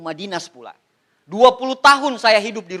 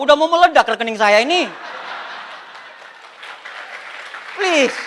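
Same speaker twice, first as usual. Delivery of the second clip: mock desperate plea; 4 semitones higher than usual. Man begging office as his account overflows, mock desperation.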